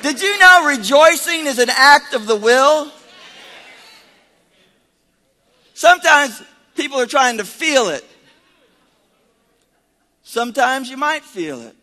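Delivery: high, loud preaching voice; forceful preaching. Real, not acted. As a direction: drive the teaching home loudly to the church.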